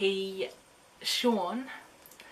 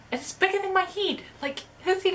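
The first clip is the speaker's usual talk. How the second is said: excited; 11 semitones higher, 4 dB louder than usual. Real, not acted. Excited about poster.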